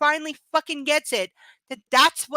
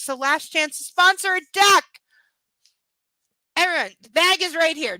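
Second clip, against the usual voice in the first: calling out; 3 semitones higher, 6 dB louder than usual. Real, not acted. Host calling for duck sponsors.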